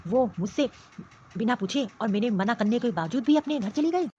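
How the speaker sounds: a possessive man fuming and threatening; angry husband. Acted. Husband rages to mother that his wife left without asking.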